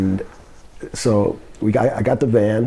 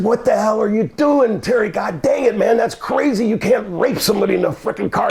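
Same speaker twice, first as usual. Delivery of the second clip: higher-pitched retold outrage; acted exasperated scolding, pitch raised throughout; 8 semitones higher than usual. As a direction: tell him off, indignant and insistent. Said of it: "And I said".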